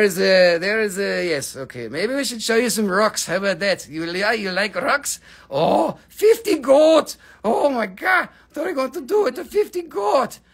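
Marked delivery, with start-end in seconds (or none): 6.1-10.4 high, loud astonished cry; surprised. Real, not acted. Host reacts to a viewer's fifty goat bid, astonished. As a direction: gasp it out amazed at the bid.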